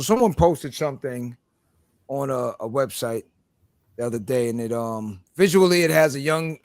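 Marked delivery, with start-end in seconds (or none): none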